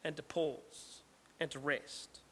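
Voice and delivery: demonstrating the pause in a quiet voice; hushed, slowing down. Shown as a demonstration. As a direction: let the voice sink to a hush as you pause. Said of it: "the word salah, which means to stop".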